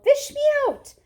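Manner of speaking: high, loud desperate cry; yelling. A narrator's reading. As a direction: yell to be pulled out. Drowning boy calls for help.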